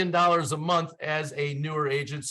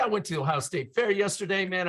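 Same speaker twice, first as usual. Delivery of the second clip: ordinary talk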